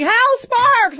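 high, loud imitated fan shriek; fan scream. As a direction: scream the name like a starstruck fan. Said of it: "There was people screaming".

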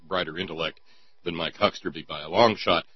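ordinary talk, nothing marked

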